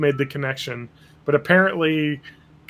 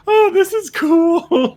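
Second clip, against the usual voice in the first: put-on very high, slow, airy girlish voice; mock naive Katara; 5 dB louder, much slower than usual. Katara cheerfully approving of evil, very high and slow. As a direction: play her as clueless and chirpy.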